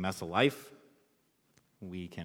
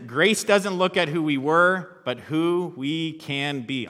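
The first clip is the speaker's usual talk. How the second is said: high, loud, slow refrain, starting on a laugh; loud repeat with a laugh; 7 semitones higher, 9 dB louder, slower than usual. Shown as a demonstration. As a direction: proclaim it again, laughing at the silent crowd. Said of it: "Say it in your heads a lot".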